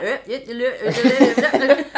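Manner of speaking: imitating a Newcastle man talking through the car window; mock Geordie babble. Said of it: "He's like".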